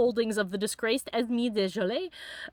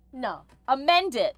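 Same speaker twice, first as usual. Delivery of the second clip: ordinary talk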